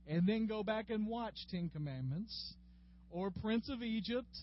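usual speaking voice

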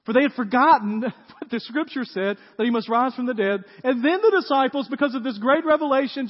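ordinary talk

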